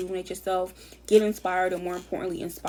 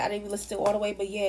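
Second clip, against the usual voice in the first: normal talking voice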